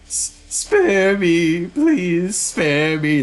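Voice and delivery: high, drawn-out wailing plea; panicked bishop's high-pitched whine; terrified begging. Acted. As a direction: beg for your life, stretching each word. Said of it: "he says".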